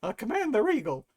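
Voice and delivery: loud, strained grunt soldier voice; standard minion voice. Acted. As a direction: bark it like a nervous foot soldier. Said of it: "the standard minion voice that I always give to".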